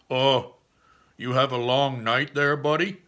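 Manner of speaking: loud officer's needling question; police officer. Acted. Officer questioning Dad stumbling from van, loud cop voice.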